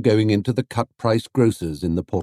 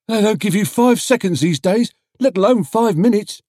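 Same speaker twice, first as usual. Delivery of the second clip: higher, louder indignant stallholder; grumbling trader; 12 semitones higher, 4 dB louder than usual. A narrator's reading. Trader complains to crowd about traffic wardens, indignant.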